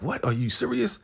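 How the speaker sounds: high-pitched, hushed astonishment; mock happy disbelief. Hearing the money is coming, playfully incredulous. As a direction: imitate delighted disbelief, pitch way up. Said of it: "So they're getting".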